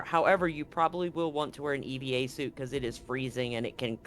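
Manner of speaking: ordinary talk, nothing marked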